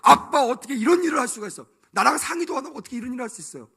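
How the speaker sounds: high, loud, fast outburst at dad; angry complaint. Pretend. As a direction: scold the father, fast and indignant. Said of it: "막 화를 내면서".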